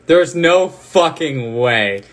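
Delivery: loud, slow disbelieving shout; shrieking jackpot winner. Real, not acted. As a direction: yell it out in total disbelief. Card hit, disbelief shouting.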